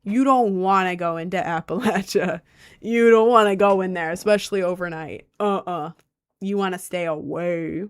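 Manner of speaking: put-on country accent, cautionary; country guy accent. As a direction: warn like a local country man. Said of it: "average looking country guy near Appalachia".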